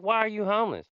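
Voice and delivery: high-pitched, incredulous retold question; freaked-out disbelief. Boss questioning his homeless day laborer, imitated freaked-out disbelief. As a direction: imitate a man stunned by what he heard. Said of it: "like, freaked out".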